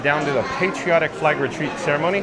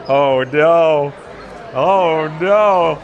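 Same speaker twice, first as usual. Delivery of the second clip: loud playful oh no; hammed-up horrified groan; mock dismay; 6 dB louder, much slower than usual. Viewer plans princess dress.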